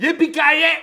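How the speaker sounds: high, loud whooping yell; shouted catchphrase. Retold or imitated. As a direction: belt the catchphrase out. Die Hard catchphrase, full out.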